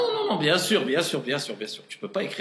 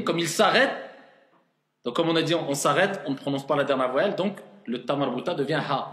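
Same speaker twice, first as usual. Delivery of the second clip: ordinary talk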